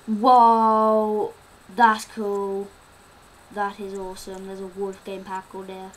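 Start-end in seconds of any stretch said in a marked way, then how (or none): 0.1-2.7 much louder amazed exclamation; amazed whoa. Real, not acted. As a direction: react to a great find. Seeing something in the game.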